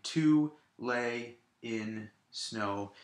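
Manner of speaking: slow "To lay in snow", one beat per syllable; separated syllables. Shown as a demonstration. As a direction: break "To lay in snow" into four separate words. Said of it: "separate all of the syllables".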